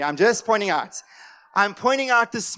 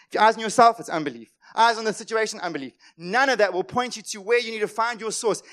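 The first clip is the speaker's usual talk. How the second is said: ordinary talk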